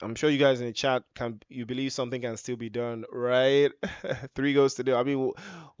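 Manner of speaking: normal talking voice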